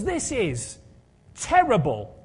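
lightly voiced evangelist preacher; Reinhard Bonnke impression. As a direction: slip back into a hint of the revival preacher. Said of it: "I don't know why I slipped into his voice there".

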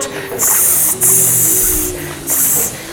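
whispered hiss of the sea, repeated; wave hissing noise. Shown as a demonstration. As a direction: hiss like crashing waves. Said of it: "we're gonna keep making this noise".

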